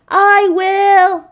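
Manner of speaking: high, loud happy answer; cheerful reply. A narrator's reading. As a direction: call back brightly. Flossie replying from afar.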